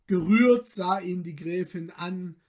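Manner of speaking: ordinary delivery, nothing marked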